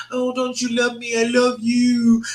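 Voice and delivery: mock clingy whine. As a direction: plead for love back. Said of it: "it's too much. It's codependent".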